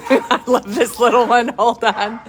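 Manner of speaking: laughing while talking. Real, not acted. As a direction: chuckle through the words. Laughing while holding puppy.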